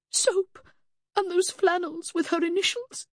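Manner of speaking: very high, evenly pitched resolve; brittle denial. A narrator's reading. Mother planning to wash her dead daughter, high-voiced denial. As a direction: hold a high, steady tone.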